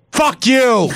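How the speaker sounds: a sudden high shriek of contempt; furious shout. Acted. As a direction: yell it with everything you've got. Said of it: "I simply have a very basic message".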